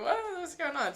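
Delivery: high-pitched mock confusion; bewildered reaction. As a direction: squeak it out, totally baffled. Acted bewildered reaction to the entrance.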